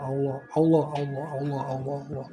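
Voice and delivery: repeated Allah fading to near silence; fading dhikr. Shown as a demonstration. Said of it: "ingetin aja Allah".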